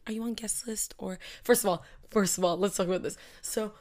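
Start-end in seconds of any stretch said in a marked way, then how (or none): 0.1-1.2 softly whispered, as if retelling a guard's question; hushed guard question. Retold or imitated. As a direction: drop it to a whisper, mimicking the guard. Guard's question, retold in a hush.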